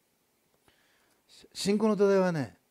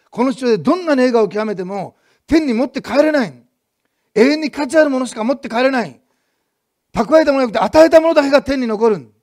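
fervent preaching; 7 semitones higher, 11 dB louder than usual. Acted. Pastor proclaiming to the congregation, fervent full-force preaching. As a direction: drive the point home to the congregation.

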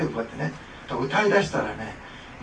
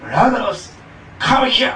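loud, high come here; English command; 8 semitones higher, 10 dB louder, slower than usual. Retold or imitated. Preacher gives the English version, loud and high. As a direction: shout it like a summons. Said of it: "英語だとね".

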